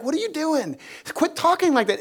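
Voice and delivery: high-pitched mock rebuke; Peter. Disciple pulls his master aside, high-pitched scolding. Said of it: "Peter rebuked Jesus".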